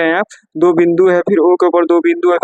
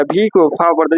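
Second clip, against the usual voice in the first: ordinary talk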